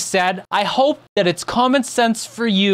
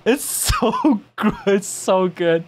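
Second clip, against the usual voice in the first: ordinary delivery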